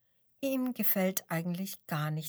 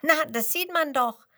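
high, loud cheeky retort; 8 semitones higher, 7 dB louder than usual. A narrator's reading. Penguin answering the lion with cheeky pride. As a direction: toss it back pertly, higher and louder. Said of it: "antwortet das Tier keck".

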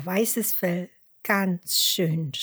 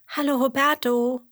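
sheep voice; 7 semitones higher, 4 dB louder than usual. A narrator's reading. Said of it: "antwortet Emmerla".

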